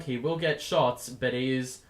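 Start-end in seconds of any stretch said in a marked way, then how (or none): none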